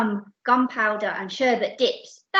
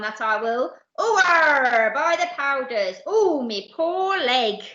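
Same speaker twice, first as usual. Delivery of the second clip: roaring pirate captain; 5 semitones higher, 5 dB louder than usual. Acted. Captain tripping over the chest, roaring and wailing. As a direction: roar it like an outraged pirate. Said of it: "roared Captain Abdul, rushing out of his cabin".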